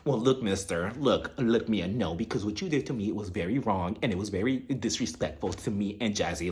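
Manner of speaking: low, hushed, stern telling-off; [name]. Retold or imitated. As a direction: scold quietly and firmly, voice dropped. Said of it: "the real [name] almost came out".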